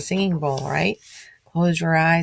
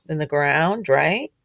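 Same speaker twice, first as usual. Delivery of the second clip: ordinary delivery, nothing marked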